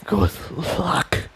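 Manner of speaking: soft, fading goodbye; a dying whisper of good luck; barely voiced last words. A narrator's reading. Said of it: "whispered towards to Tiptoe".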